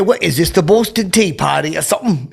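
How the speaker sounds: high-pitched, lightly mocking British voice; mock British accent. Razzing Ben Cumberbatch backstage, mock British accent. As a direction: tease in a put-on British accent. Teasing a British actor, put-on voice.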